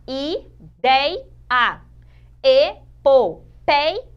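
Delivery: loud, separated syllables in "ideia" and "epopeia"; dividindo em sílabas. Shown as a demonstration. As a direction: break the words up, isolating "dei" and "pei". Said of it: "olha lá". ("ideia" e "epopeia" sílaba por sílaba, bem marcadas)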